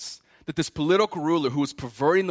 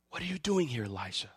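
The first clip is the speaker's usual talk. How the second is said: soft whispered question; 6 semitones lower, 9 dB quieter than usual. Acted. God's whisper voiced softly.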